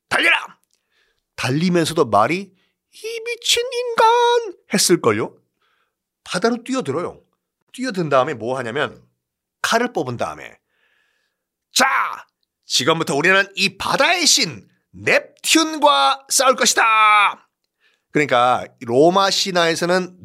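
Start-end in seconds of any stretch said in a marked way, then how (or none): 0.1-0.6 commanding shout. Acted. Emperor ordering charge. 2.9-4.6 very high, slow squeaky horse; horse's exasperated voice. Acted. 11.7-17.5 rallying war cry. Acted. Emperor declares war on sea.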